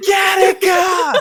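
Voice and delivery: very high, stretched-out mock scream; hammed-up wild battle cry; imitated crazed yell. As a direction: yell it like a guy gone crazy at paintball. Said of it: "he starts yelling".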